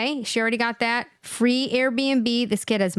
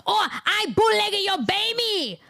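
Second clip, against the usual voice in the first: higher, mock-accented demand, a touch faster then slowing; hammed-up caricature of a scolding official; shouted mock accent demand; mock Chinese accent; 5 semitones higher than usual. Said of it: "China said".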